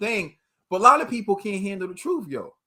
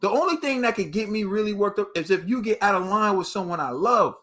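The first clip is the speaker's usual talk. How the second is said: normal talking voice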